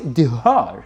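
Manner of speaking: normal talking voice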